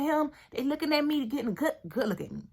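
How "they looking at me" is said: ordinary talk, nothing marked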